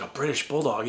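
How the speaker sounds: put-on a little kid echoing in quiet awe; awed kid question. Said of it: "I was like".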